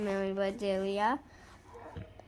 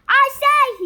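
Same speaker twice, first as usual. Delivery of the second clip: high, loud call; 13 semitones higher, 14 dB louder than usual. A narrator's reading. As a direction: call across the house. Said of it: "he called".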